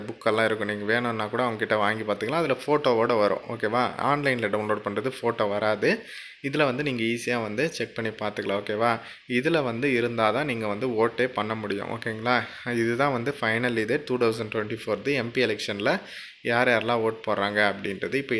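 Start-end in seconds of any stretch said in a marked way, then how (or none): none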